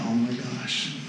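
very soft, low aside; hushed dismay. Retold or imitated. Speaker seeing red on test results, hushed dismay. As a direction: say it under your breath, dismayed.

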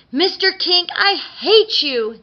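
high, loud anguished cry; Tooth Fairy wail. A narrator's reading. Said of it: "the Tooth Fairy wailed".

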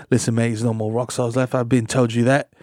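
put-on low, soft London accent; British accent. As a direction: talk like a London rocker. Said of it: "come from across the pond, mate".

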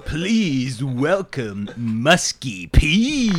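slow, drawn-out groovy emcee; groovy eighties MC. Acted. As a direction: hype up the crowd like a seventies host. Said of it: "Think s".

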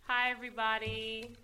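loud projected hello. Real, not acted. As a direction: project it so the room hears. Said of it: "You know we need to hear you".

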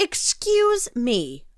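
slow, stretched excuse me with a falling end; drawn-out mock indignation. Real, not acted. Cohost repeats her reaction slowly, mock indignant. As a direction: drag out "Excuse me" in mock outrage.

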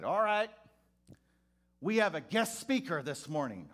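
high, loud, hearty preacher greeting; jolly pastor. Retold or imitated. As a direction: boom it out like a beaming old reverend. Pastor announcing from pulpit.

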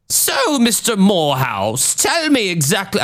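a deliberately bad Scottish accent, jumping high on the first word; awful Scottish accent. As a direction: ham up a Scottish investigator's accent. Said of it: "I'm doing an awful Scottish accent".

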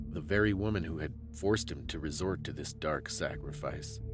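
usual speaking voice

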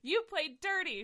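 sulky kitten complaining; whine. Acted. Tinkerbell whining at Mistopheles, hammed-up. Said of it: "she whined again".

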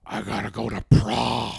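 put-on low, croaky old man; lightly voiced old man whining. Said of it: "His old man voice?".

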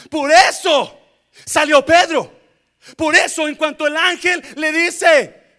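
very loud, high preacher's shout; booming pulpit cry; full-force preaching. Real, not acted. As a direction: shout it in short, punched phrases.

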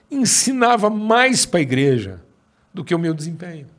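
slow, loud and emphatic; slow preacher's build; emphatic preaching. Acted. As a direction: drive each phrase home like a sermon.

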